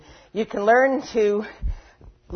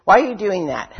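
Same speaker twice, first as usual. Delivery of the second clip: loud scolding question; scolding critic; 2 semitones higher, 7 dB louder than usual. Retold or imitated. Inner critic scolding you, louder. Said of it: "louder than all the other voices".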